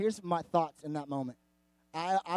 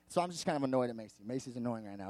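lower, softer, quicker muttered aside; under-the-breath grumble, lower and quicker; quiet aside; 7 semitones lower, 7 dB quieter, faster than usual. Real, not acted. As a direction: drop the voice as if she might hear. Muttered aside about annoying seatmate.

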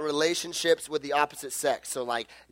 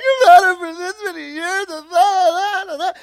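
put-on high, louder, crying girl voice; mock weepy girlfriend; tearful girl; 11 semitones higher, 5 dB louder than usual. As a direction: sob through the words. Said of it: "she's just like".

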